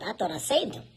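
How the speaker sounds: normal talking voice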